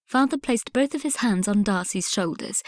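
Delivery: ordinary delivery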